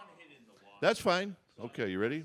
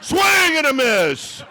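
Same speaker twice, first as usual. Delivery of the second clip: announcer shout; 11 semitones higher, 8 dB louder, slower than usual. Acted. Mock broadcast of a missed swing.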